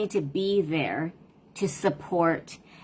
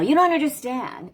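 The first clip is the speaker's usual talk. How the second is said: put-on sharp, louder teen outburst, pitched higher; kid yelling; 7 semitones higher, 4 dB louder than usual. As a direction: fling it back like a frustrated kid. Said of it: "they yell".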